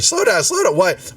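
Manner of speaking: high, flustered interruption. Acted. Valletta can't follow Nim's furious motions, flustered. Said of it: "he's making all these furious motions".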